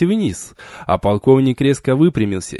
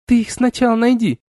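mocking retort; 10 semitones higher than usual. A narrator's reading. Girl mocks beaten colonel.

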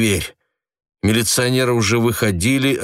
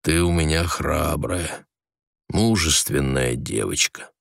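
gently lower, softer; tender and low; 5 semitones lower, 4 dB quieter than usual. A narrator's reading. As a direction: soothe her quietly. Husband tenderly praising wife.

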